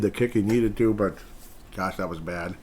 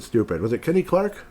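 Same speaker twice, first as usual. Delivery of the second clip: normal talking voice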